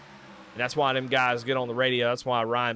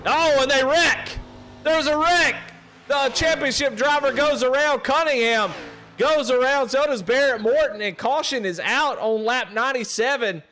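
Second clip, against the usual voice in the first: much higher, shouting excited call; wreck call; 12 semitones higher, 6 dB louder than usual. Real, not acted. Commentator calling a multi-car wreck, loud excited shouting. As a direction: yell it out as the wreck happens.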